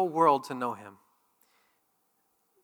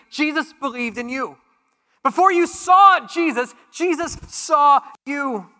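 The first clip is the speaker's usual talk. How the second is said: preaching at full force; 8 semitones higher, 8 dB louder than usual. Acted. Pastor telling the church Jesus saw them, soaring preach. Preaching climax on God searching.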